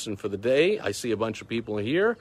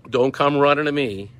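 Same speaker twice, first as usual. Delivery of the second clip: loud, raised scolding voice; loud scolding warning; 4 semitones higher, 7 dB louder than usual. Real, not acted. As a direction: wag a finger loudly.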